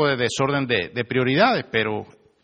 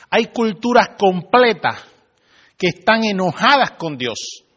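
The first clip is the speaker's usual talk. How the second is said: louder, higher, slow and weighty; slow emphatic preaching; 5 semitones higher, 5 dB louder, slower than usual. Real, not acted. Preacher warning the congregation, slow and emphatic. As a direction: drive home the point to the congregation.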